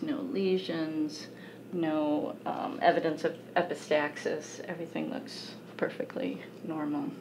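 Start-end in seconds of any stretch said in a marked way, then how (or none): 4.6-7.1 whisper. Real, not acted. Doctor's quiet aside.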